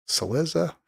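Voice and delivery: "Cillizza" stressed on the second syllable, cil-LIZ-za. Shown as a demonstration. Host testing the name aloud, second syllable stressed. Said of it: "where you stress the accent".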